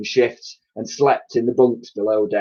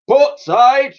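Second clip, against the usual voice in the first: much higher, loud command; shouted order; 6 dB louder than usual. A narrator's reading. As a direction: bellow it across the deck. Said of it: "ordered the Admiral".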